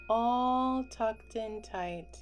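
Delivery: ordinary delivery, nothing marked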